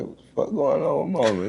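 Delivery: high, stunned outburst of disbelief; can't believe what you're seeing; shocked disbelief. Retold or imitated. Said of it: "I was like".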